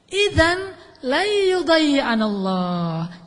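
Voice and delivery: slow, weighty, each word drawn out; powerful declaration. Retold or imitated. Hajar sending off Ibrahim, slow firm faith. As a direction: say it slowly with conviction. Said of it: "kalimatnya powerful banget".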